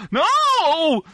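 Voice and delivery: high, drawn-out comic "no"; hammed-up wail of refusal; mock refusal. Customer wishing he could refuse to pay, mock protest.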